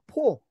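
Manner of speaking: "포" said as a long po, between o and u; native pronunciation. Shown as a demonstration. Teacher showing the class the native "poor". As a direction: say "포" with a long rounded o. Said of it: "포에 가깝습니다".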